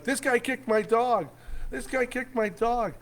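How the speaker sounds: high-pitched mock yell; retold yelling. Dog owner complaining to the whole campground, high mock yelling. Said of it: "this guy starts yelling at me".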